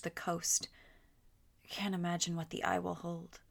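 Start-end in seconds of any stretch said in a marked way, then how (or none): none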